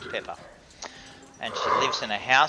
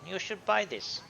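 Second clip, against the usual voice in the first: shopkeeper voice; 8 semitones higher, 8 dB quieter than usual. Acted. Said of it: "he says".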